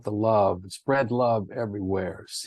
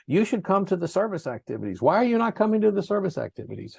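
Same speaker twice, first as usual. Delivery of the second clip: nagging phone call; 9 semitones higher, faster than usual. Retold or imitated. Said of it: "tell them".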